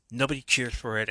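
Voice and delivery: ordinary talk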